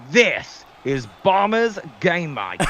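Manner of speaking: slow, hammed-up American announcer accent; jokey American-accented sign-off, drawn out; put-on American accent. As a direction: stretch it out in a mock American accent. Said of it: "I didn't wanna do the American accent".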